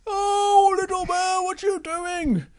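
very high dismayed wail; dismayed cry. Acted. Little Bear swallowed by squid.

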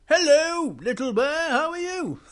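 Power Rangers greeting. Acted. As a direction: greet the bear brightly. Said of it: "They sound just like Big Bear".

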